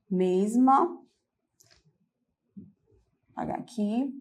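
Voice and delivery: slowly, word by word, pausing to write; slow dictation. Shown as a demonstration. Teacher dictating while writing on the board slowly. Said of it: "quem quiser também fazer essa anotação".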